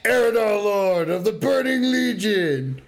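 high, slow, grandiose demon lord boast; booming Jaraxxus. Retold or imitated. Friend quoting a game boss to his co-host, grandiose boom. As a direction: announce yourself like a towering demon lord. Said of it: "I love that fucking voice line".

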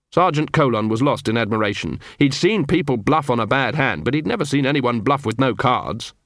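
ordinary delivery

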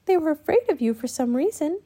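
put-on high child voice; gentle girl. A narrator's reading. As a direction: explain kindly to a hurt bird. Annie talks gently to the raven.

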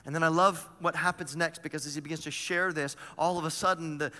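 usual speaking voice

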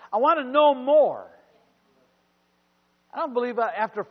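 loud, rising preacher's cry; full-force sermon delivery; preaching. Acted. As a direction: preach it out to the congregation.

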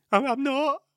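put-on very high squeaky child denial; guilty boy insisting he's not crying. Son denies, voiced high.

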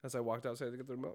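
normal talking voice

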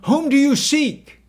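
high, loud demand across a distance; calling out. A narrator's reading. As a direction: shout the question out to the crowd. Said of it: "Jesus called out".

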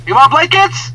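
lightly voiced maid, high and loud; movie maid. Maid offering blankets to a guest, high calling voice. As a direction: call it out high through a hotel door. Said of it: "and the maid goes".

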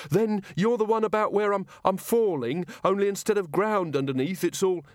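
high, anxious character voice; frightened guard. Acted.

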